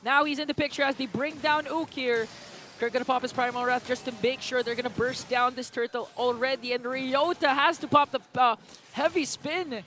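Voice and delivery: excited shoutcaster in full flow; live play-by-play. Real, not acted. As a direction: call the fight fast and high as it happens.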